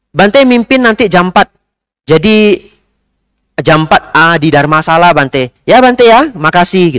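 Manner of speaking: high, loud commanding lady; bossy order. Retold or imitated. Pushy lady commands the monk, then thanks him, high and bossy. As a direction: tell the monk what to do, no question.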